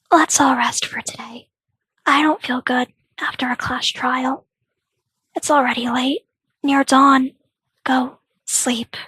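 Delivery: soft, slow, hushed voice; weary near-whisper. Acted. Sending everyone to rest after the trial, hushed and weary. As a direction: murmur it as if drained of energy. Weary quiet character sends all to sleep.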